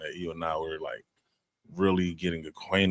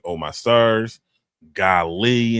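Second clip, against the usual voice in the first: louder, mock-gushing clean exclamations; over emphatic; 4 semitones higher, 6 dB louder, much slower than usual. Shown as a demonstration. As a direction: overplay the polite swear substitutes. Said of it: "o-, over emphatic".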